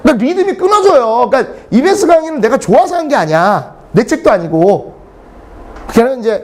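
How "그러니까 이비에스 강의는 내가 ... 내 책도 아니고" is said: ordinary delivery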